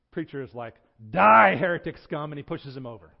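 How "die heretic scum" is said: explosive, drawn-out DIE, very loud; hammed-up zealot's outburst; mock furious shout. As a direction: roar it as a comic sudden condemnation. Preacher turns on man in punchline.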